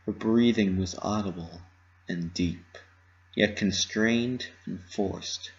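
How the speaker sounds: ordinary delivery, nothing marked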